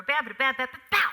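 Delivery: loud punched syllables, short final hit; rhythmic door slam. Shown as a demonstration. Actress showing how she closed the door, punchy scatted rhythm. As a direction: scat it sharply, landing hard on bow. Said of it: "with George, I might have closed".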